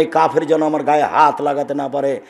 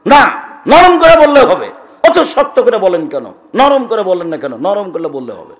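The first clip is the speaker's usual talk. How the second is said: retold loud insistence; 2 semitones higher, 7 dB louder than usual. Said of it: "আবার খুব চিৎকার করে বলছে".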